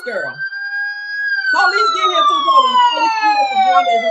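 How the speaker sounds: very high wailing siren call. Pretend. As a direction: wail it out like a police siren. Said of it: "I need my sound effects for this one".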